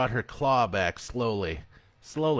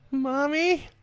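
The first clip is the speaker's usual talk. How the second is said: put-on very high, frightened boy's cry; a small boy pleading in terror; terrified child; 4 dB louder than usual. A narrator's reading. Boy facing his monstrous mother, high and terrified.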